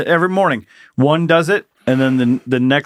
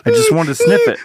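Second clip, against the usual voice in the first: voicing the cat. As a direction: plead like a guilty pet. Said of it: "he was just like".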